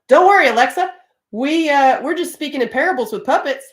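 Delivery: imitating an over-bright reply; mock reassurance. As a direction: raise pitch and volume to placate a device. Retold reply reassuring the Alexa device.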